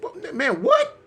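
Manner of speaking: high rising panicked what; bewildered squeak at the end; climbing to a high what; confused alarm. Retold or imitated. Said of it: "I'm like".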